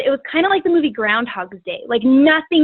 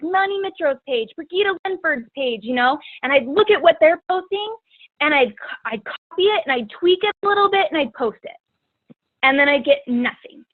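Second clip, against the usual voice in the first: normal talking voice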